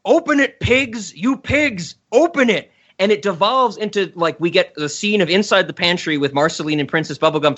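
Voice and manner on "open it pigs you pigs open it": raised, higher shouts of open it; yelling at a locked door, half-acted; screaming at the lock. The Moon screams at the pantry lock, mock yelling. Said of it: "she starts screaming at the lock".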